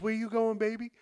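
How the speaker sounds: high, sweet mock mom; lightly voiced caring older lady; motherly lady voice. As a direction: ask like a doting mother. Motherly driver voiced in story.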